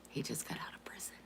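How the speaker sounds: whisper. Real, not acted. Confiding secret about mom's boyfriend.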